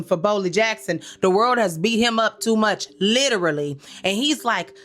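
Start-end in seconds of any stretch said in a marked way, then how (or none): none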